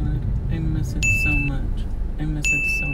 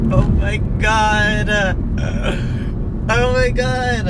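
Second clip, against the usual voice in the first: high, stretched out and louder; delighted surprise; 10 semitones higher, 5 dB louder, slower than usual. Real, not acted. Seeing Burger King, to viewers, delighted stretched surprise. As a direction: gasp it out in disbelief.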